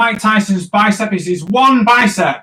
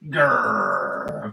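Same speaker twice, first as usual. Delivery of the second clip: low, drawn-out mock growl; grumpy growl; 12 semitones lower, 7 dB quieter than usual. Cartoonist voicing his grumpy boxer drawing, low growl. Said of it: "and he's quite grumpy".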